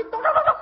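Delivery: very high, laughing tok tok; imitated knocking. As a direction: knock with the voice, giggling. (tiruan ketukan sambil tertawa)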